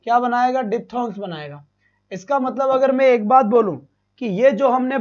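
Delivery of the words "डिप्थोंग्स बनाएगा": usual speaking voice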